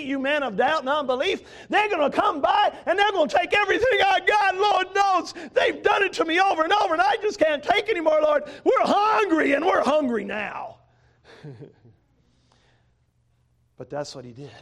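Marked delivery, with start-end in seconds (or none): 0.0-8.4 high, loud pleading shout; desperate cry to God. Acted. Gideon cries out to God. 8.6-9.5 high, loud cry of hunger; loud plea. Acted. Gideon pleads with God. 9.5-10.8 slow plea. Acted. Gideon pleads with God.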